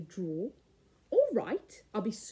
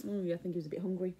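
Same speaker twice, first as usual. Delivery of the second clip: fast hushed whisper; quick whispered aside; 5 semitones lower, 6 dB quieter, much faster than usual. Real, not acted. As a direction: whisper it quickly as a side comment. Aside to children during story.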